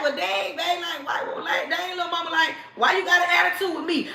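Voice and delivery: soft, baffled and a bit hurt; confused man. Retold or imitated. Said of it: "And he like".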